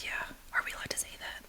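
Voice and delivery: quick unvoiced whisper, as if afraid to be overheard; retold whisper. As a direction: breathe it fast like a forbidden question. Coworker asks nervously, retold.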